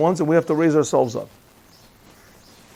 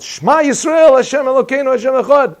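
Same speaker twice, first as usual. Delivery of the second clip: high, loud desperate cry of Shema; screamed prayer; 9 semitones higher, 5 dB louder than usual. Retold or imitated. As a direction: scream it out in terror.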